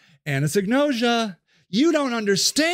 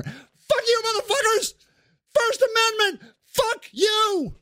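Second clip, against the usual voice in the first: furious shout; 11 semitones higher, slower than usual. Acted. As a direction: scream it at all of them. Sage yells.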